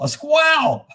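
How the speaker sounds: high laugh through the word squirrel. Real, not acted. To the chat about Bullwinkle, laughing high. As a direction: cracks up at squirrel.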